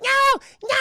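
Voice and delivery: tiny cookies panicking in flames; high shrill panic; panicked squeal. Acted. Gingerbread men on fire.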